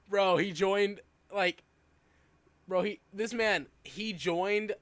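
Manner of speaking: bursting out laughing, high and loud; cracking up, then blurting it out; loud laughter. Real, not acted. Streamer cracks up at chat.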